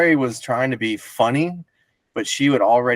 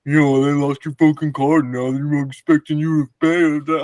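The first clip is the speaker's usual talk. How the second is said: mock condescending drunk friend; 4 semitones higher than usual. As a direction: talk down to him, lightly. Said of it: "really fucking condescending".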